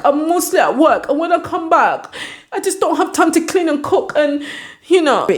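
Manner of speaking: high-pitched, whiny fake weeping; hammed-up crying boyfriend; mock crying. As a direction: plead tearfully in a put-on high voice. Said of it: "[name] starts crying".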